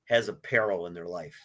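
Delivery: normal talking voice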